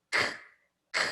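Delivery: "K" as a bare voiceless click; short separate "k" bursts without a vowel; isolated K sound. Shown as a demonstration. Teacher repeating the sound of C, bare "k". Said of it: "Vamos de novo?".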